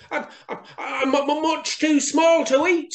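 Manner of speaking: very high stammering mouse; gulping frightened mouse. A narrator's reading. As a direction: plead in a squeaky stammer. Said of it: "Little Mouse gulped".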